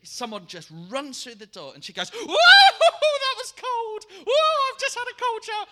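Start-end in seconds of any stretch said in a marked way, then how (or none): 2.1-5.7 very loud, high whooping shriek; hammed-up cold-shock squeal. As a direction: imitate someone yelping after an icy shower. Imitating woman bursting in from cold shower.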